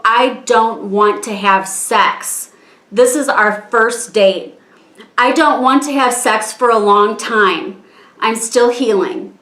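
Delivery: slow, deliberate, each word weighted; measured, emphatic retelling of a refusal; firm refusal. Retold or imitated. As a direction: spell it out slowly and firmly. Retelling her repeated firm refusal.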